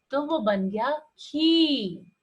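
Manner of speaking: "खी" drawn out slowly; long ee sound. Shown as a demonstration. Teacher stretching "खी" for the class. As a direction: hold the ee of "खी". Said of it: "बड़ी sound आ रही है".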